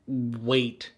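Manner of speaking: ordinary talk, nothing marked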